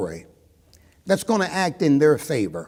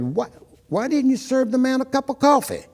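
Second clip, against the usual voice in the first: raised, high-pitched challenge; indignant outburst; 11 semitones higher, 4 dB louder than usual. Retold or imitated. Diners jumping on the owner over the coffee, indignant. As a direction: retell an outraged demand, pitched high and louder. Customers jump on the owner, retold.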